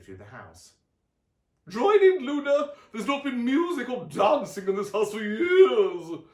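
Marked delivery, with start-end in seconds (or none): 1.7-6.2 very high, loud call; Aunt Summer urges Luna to dance, loud high call; excited aunt calling. A narrator's reading. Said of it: "said Aunt Summer".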